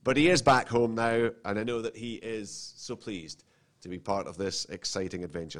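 ordinary delivery

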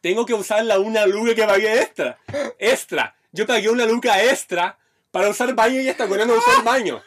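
loud, high-pitched replayed outrage; indignant rant. Man telling himself he paid extra for nothing, indignant rant.

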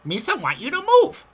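high, fast Gungan voice; squeaky alien sidekick voice; Jar Jar voice. Retold or imitated. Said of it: "speak like Jar Jar".

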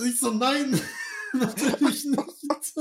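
high, slow, laughing through it. Real, not acted. Doorman retelling a fake name, laughing. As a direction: laugh out the denial.

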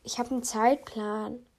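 ordinary delivery

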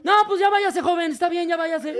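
cop waving off. Retold or imitated. Cop waves them off.

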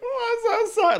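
high loud crying apology; tearful apology. Retold or imitated. Caught child apologizes to mom, high loud teary voice. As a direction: blurt a sorry through tears, high and loud. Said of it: "I was like".

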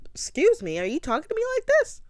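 high-pitched, affronted imitation; indignant disbelief. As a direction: pitch climbing into an incredulous question. Acted quarrel, her offended reply.

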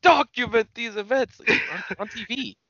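high, put-on theatrical voice; grandiose wrestler impression. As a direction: declaim it like an eccentric showman. Co-host continues the wrestler impression.

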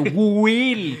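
exaggerated WILL with a long drawn-out start; articulating. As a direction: stretch WILL out high, as if teaching it. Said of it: "Y yo articulando".

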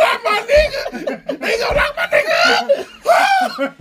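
high, loud, slow yell through laughter; hammed-up holler while cracking up; laughing outraged shout. Retold outrage, laughing hard.

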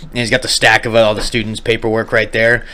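ordinary delivery

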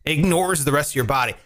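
ordinary delivery

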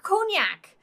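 cognac blurted high and loud; exasperated outburst. Real, not acted. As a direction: burst out with cognac in frustration. Struggling over the French name.

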